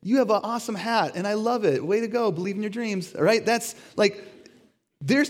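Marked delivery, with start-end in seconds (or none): 0.0-3.1 high-pitched cheerleading compliments; put-on upbeat encouragement, pitched up; mock gushing praise. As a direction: gush with exaggerated niceness. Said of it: "has to be like".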